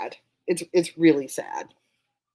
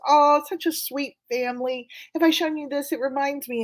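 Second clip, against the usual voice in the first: higher, cooing mother voice; sweet old mom; 8 semitones higher than usual. Retold or imitated. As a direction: coo over the photo like a doting mom. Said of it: "she goes".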